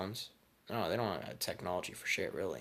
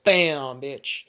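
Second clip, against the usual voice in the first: high, very loud impact yell; shouted attack cry; 6 semitones higher, 12 dB louder than usual. Retold or imitated. Naruto landing a blow in rage mode, shouting. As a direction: punch BAM out as a battle shout.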